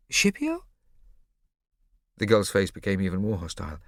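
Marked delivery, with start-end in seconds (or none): 0.1-0.7 surprised. A narrator's reading. Boy surprised at name.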